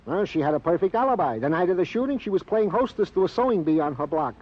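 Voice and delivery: normal talking voice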